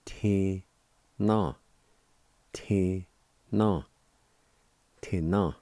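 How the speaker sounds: "Te na" said as two separate syllables, te then na; Cherokee word demo. Shown as a demonstration. Teacher showing listeners "Te na", spaced out. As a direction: spell out "Te na" syllable by syllable. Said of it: "it's pronounced like this".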